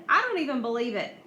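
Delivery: ordinary delivery, nothing marked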